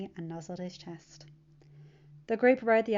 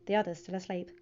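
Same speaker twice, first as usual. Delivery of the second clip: plain reading voice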